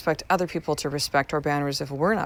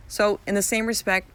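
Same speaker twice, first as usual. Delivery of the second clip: normal talking voice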